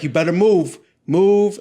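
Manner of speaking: acted angry shout building to the end; upset command. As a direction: order people aside, retelling it. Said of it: "I was so upset".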